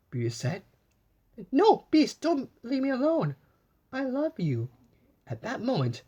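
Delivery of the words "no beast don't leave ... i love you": high, loud desperate plea. Acted. Beauty pleading with the dying Beast, high loud cry. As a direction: cry out to keep him alive.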